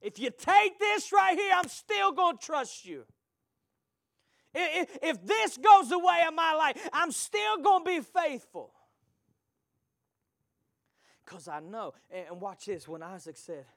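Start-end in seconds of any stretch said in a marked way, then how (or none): 0.0-8.7 high, shouted vow of faith; preaching at full force. Acted. Preacher vowing trust to God before the church, full-force preaching. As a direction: cry it out defiantly.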